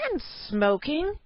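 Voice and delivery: high, very slow disgusted jab; scandalised. A narrator's reading. Cave wife spots her husband smoking, slow disgusted jab. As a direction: stretch it out in disgust.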